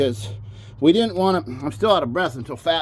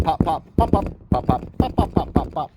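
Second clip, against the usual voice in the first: quick pops climbing far higher in pitch, then dropping back; popping firing order; 5 semitones higher than usual. Retold or imitated. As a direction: tap out the uneven firing rhythm like popping cylinders. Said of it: "So I go pop".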